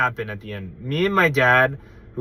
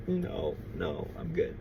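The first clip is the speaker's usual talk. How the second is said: shy mumble; 8 semitones lower, 12 dB quieter than usual. Retold or imitated. Shy kid refusing tangerine.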